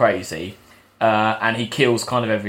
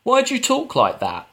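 mock character question; 11 semitones higher than usual. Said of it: "a character says".

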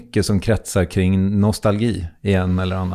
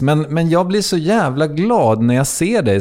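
ordinary delivery

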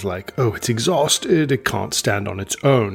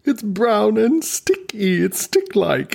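much higher, wondering amazement; delighted surprise; 10 semitones higher than usual. Real, not acted. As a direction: discover the joke is funny. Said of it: "am I laughing?".

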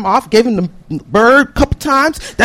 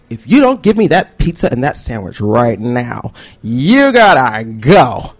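low, drawn-out threat; menacing ultimatum; 13 semitones lower, slower than usual. Acted. Man ordering students to leave, menacing ultimatum. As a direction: lay down the law, low and slow at the end.

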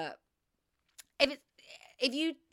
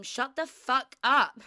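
slow, louder snap; mock telling off; 5 semitones higher, 8 dB louder, slower than usual. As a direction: shut him up, hammed up and slow. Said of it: "I'd have to say to him".

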